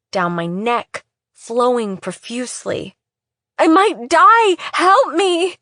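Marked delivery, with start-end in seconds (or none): 3.6-5.6 panicked cry for help. Acted. Bleeding girl panics.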